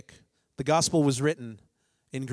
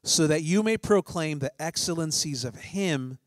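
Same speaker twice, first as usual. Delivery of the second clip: slow, loud proclaiming of scripture; 2 semitones higher, 3 dB louder than usual. Acted. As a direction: proclaim it boldly, slowly. Reads First Peter aloud.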